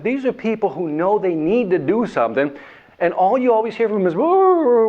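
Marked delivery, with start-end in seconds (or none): 4.1-4.9 high, loud mock whine; whining groan. Speaker mocks complacent prospects, high whining groan.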